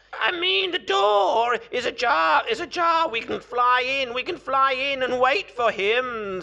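high, very loud crowing insistence; exasperated cockerel. A narrator's reading. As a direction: squawk it out to get through to them. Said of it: "said the cockerel".

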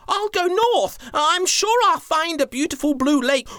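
mummy elephant volunteering to the chief; mummy elephant calling out. Acted. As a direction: call out brightly to volunteer. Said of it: "she called out".

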